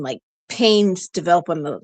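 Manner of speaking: ordinary talk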